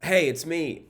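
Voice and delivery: high-pitched calling out from afar; mock yell across the woods to get his attention; retold holler, pitched well up; imitated yelling. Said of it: "she's yelling to him".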